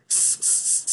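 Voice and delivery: स hissed with no voice; wrong s hiss. Shown as a demonstration. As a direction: hiss स softly. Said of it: "ये गलत है".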